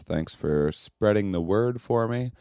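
usual speaking voice

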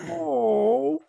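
high, drawn-out mock "oh"; mock hurt. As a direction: act out a dad pretending his child got hurt. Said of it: "looks at his daughter and goes".

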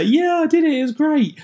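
high-pitched pretend cheer to cover not training; fake cheerful lie. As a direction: sell an obvious lie about the workout. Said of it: "obviously you can lie and say".

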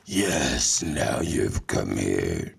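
very low, slow sinister growl; menacing horror villain voice; horror movie villain. Pretend. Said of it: "can you do the voices from the horror movie".

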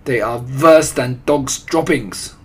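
loud, slow witch outburst; forceful accented villainess; screeched. A narrator's reading. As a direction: lay down each word with loathing. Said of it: "screeched the Grand High Witch".

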